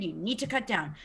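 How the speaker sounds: normal talking voice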